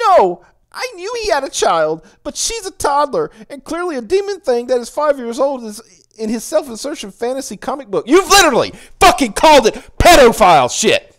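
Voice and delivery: high, loud whiny crazy cat dad; squeaky cat dad ranting; Mark Lombardi voice. Pretend. Mocking Lombardi's reply, continued.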